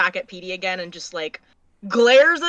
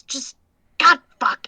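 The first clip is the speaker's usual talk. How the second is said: frustrated outburst; 4 semitones higher, 5 dB louder than usual. Acted. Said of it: "looks at him again and just goes".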